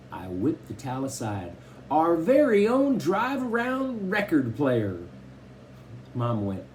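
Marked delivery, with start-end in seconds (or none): 1.9-5.0 high, grand showbiz announcement; mock announcer. A narrator's reading. Kenneth unveiling the record player, mock announcer. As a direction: announce it like a game-show host.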